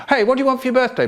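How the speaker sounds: put-on high-pitched little boy trying to chat; coaxing child. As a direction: pipe up to distract big brother. Child trying to distract chanting brother.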